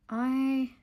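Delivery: happy enough to cry, voice lifted; overjoyed, near tears. Real, not acted. Vlogger sees her new letter stamp for the first time, overjoyed and near tears. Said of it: "I'm actually getting emotional".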